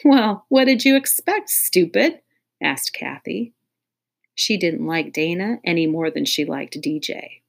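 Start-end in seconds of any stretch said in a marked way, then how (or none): 0.0-2.2 mocking. Kathy mocks crying Dana.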